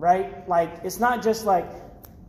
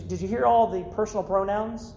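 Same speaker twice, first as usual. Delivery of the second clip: normal talking voice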